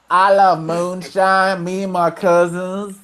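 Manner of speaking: slow mock hillbilly drawl; hammed-up Southern American voice; bad Southern accent. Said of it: "that's a pretty bad Southern accent".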